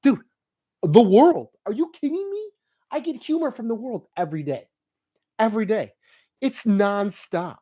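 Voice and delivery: excited. Retold or imitated. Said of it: "I go".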